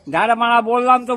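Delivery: loud, irritated insistence; exasperated. Real, not acted. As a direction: push back loudly, out of patience.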